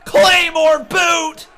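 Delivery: screaming ringside play-by-play, high; wrestling commentator. Real, not acted. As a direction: call the near falls at full hype. Live play-by-play of match.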